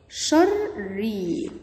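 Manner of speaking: «شري» very slowly, split into drawn-out syllables; syllable breakdown. Shown as a demonstration. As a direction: stretch «شري» out piece by piece. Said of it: "تعالوا نشوف هنحللها ازاي". («شري» مقطّعة ببطء شديد)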